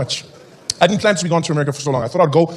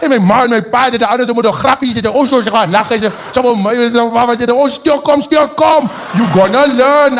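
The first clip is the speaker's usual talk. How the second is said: higher, louder torrent of mock Afrikaans; gushing Afrikaans fan; 5 semitones higher, 4 dB louder than usual. Fan chattering on to baffled comedian, excited Afrikaans gabble. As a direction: pour out excited, unintelligible Afrikaans. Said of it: "he's like".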